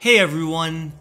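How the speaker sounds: usual speaking voice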